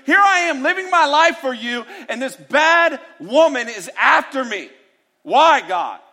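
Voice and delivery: high, strained lament; complaining Elijah. Acted. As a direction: cry out to God as the lone prophet. Elijah's lonely complaint acted out.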